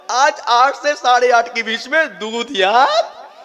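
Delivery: loud, high-pitched sermon delivery; full-force preaching. Acted. Preacher warning followers that every vow gets tested, full force. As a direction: drive the rule home loudly.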